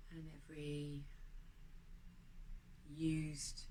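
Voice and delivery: quiet guided breathing meditation voice. Acted. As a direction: lead the breath calmly and gently.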